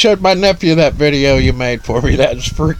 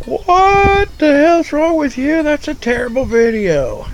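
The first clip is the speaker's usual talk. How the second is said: mock outraged viewers; 12 semitones higher than usual. Streamer imitating everybody scolding Misty, mock outrage. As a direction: stretch it high like appalled viewers. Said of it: "everybody's like".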